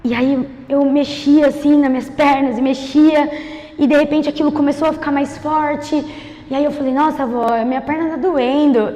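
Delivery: low, soft, hushed storytelling; testemunho em voz contida. Real, not acted. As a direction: tell it low and quiet, holding back before the climax. Hushed, slow-building testimony storytelling. (voz baixa e contida de testemunho)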